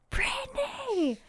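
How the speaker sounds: a scolding sister who can't believe it; exasperated outburst at sister. Retold or imitated. Scolding her sister over weed-smelling clothes, high exasperated cry. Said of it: "And I'd be like".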